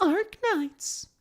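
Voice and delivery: high mock-American Arknights, echoing the clip; a mock echo of the voice line; mimicking the clip. Echoing the game clip's line.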